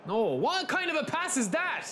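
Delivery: high, exasperated outburst at a bad pass; real frustration rising; getting angry. Real, not acted. Gamer fuming at his player's pass, angry. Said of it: "Oh, I'm getting angry".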